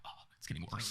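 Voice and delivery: hushed worried whisper. Retold or imitated. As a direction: whisper it to yourself. Retold thought at grimy sink.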